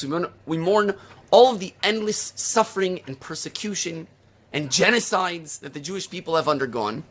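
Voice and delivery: ordinary talk, nothing marked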